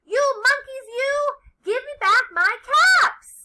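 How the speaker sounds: high, loud peddler's shout; exasperated storybook yelling; angry shout. A narrator's reading. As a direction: holler at the thieving monkeys. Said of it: "And he shouted".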